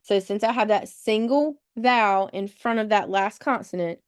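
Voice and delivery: ordinary delivery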